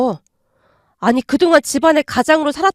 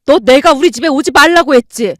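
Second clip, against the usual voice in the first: loud, furious tirade at the daughter-in-law; furious scolding; 5 dB louder than usual. Acted. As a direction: tear into her, no holding back. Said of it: "소리치셨어요". (며느리에게 삿대질하며 크게 호통치는 엄마)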